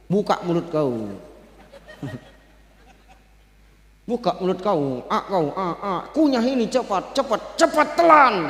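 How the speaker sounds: bossy, impatient, hurry him; rough Abu Bakar. Acted. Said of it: "Abu Bakar beda". (Abu Bakar kasar menyuapi, lebih pelan)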